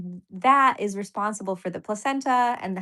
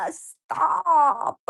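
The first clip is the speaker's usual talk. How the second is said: drawn-out, high, loud "stop" after a laugh; laughingly scold, stretching the word out; playful protest; 8 semitones higher, 5 dB louder than usual. Real, not acted. Streamer brushing off an offer to make her a mom, playful protest.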